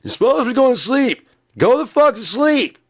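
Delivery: high, full-volume screaming; comic enraged shouting; pissed off. Pretend. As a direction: scream it at someone who won't doze off. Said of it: "I'm getting pissed off".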